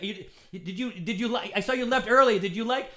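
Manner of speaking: high, rising flustered questioning; awkward pleading. Retold or imitated. Comic awkwardly asking a woman, high flustered. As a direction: fumble the questions nervously.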